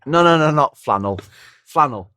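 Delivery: mock-frantic self-correction; flustered correction. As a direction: correct yourself urgently and get it wrong. Retold flustered attempt to correct himself.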